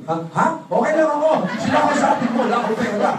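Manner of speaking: a caught-out customer stammering excuses; hammed-up fluster, pitched high; flustered shopper. Broke shopper flustered by clerk.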